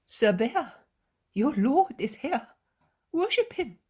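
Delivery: pleading mother. Acted. Mother urging her daughter to worship, pleading. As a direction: urge your daughter gently but firmly.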